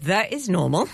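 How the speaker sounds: usual speaking voice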